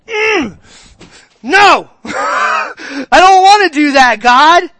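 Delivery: loud, high, slow whiny shout of protest; hammed-up wailing refusal. Preacher acting out refusing God's correction, with a loud mock wail. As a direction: wail it like a child refusing, half-laughing.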